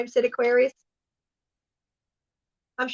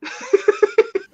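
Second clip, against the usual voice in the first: breathy giggle; 10 semitones higher than usual. Real, not acted. Airy laugh.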